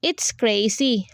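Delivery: plain reading voice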